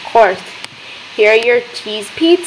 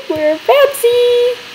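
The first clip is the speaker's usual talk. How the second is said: excited; 13 semitones higher, much slower than usual. Real, not acted. Pleased with restaurant.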